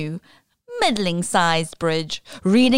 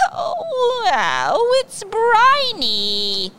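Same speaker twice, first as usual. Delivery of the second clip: prissy pirate; 14 semitones higher, 3 dB louder, much slower than usual. Acted. Pirate tastes soup.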